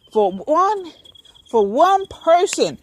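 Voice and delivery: ordinary talk, nothing marked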